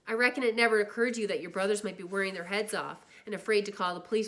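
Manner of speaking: loud angry scolding. Acted. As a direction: tear into him. Older brother scolding.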